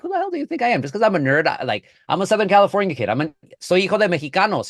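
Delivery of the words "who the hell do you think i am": higher, mock-offended comeback; mock indignant retort. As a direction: toss it back with playful outrage. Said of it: "I'm like".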